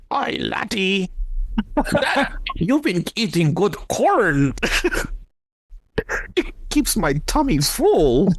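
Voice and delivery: high, slow, hammed-up Scottish monster voice; a goofy sea monster in a mock Scottish accent. [name] voicing the Loch Ness monster greeting them, hammed-up Scottish accent. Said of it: "it had an accent".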